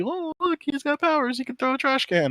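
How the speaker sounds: mock happy. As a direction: parody a wide-eyed, gee-whiz cartoon tone. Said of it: "it's all fucking, like, happy".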